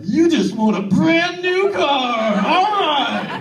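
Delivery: high, loud, slow hammed-up cheer; mock excited cheer. Comedian mocking the excuse, hammed-up cheer. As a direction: cheer like handing out a prize. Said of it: "It's never".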